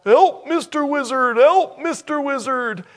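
high, loud cartoon cry for help; mock scream. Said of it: "scream out".

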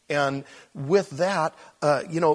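ordinary delivery

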